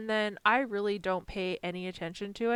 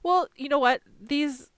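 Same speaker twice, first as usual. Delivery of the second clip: higher and louder burst of realization; sudden realization; 7 semitones higher, 8 dB louder than usual. Real, not acted. Crafter suddenly remembering the software's spell check, excited. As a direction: pop up with a bright idea.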